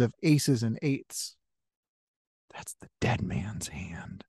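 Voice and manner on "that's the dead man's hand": spooky lower whisper. Acted. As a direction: breathe it like a ghost story. Spooky reveal in the story.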